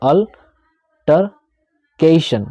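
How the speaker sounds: altercation with the opening "al" stretched out long; long drawn al. Shown as a demonstration. Teacher stretching the word for his students, slow and drawn. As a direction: hold the "al" of altercation, then finish it. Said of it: "थोड़ा लंबा चलेगा".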